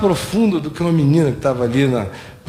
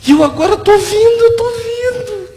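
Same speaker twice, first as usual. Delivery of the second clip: imitated crying outburst, very high and loud; tearful revelation; 8 dB louder than usual. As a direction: cry it out as the overwhelmed man. Acting man's tearful sudden revelation.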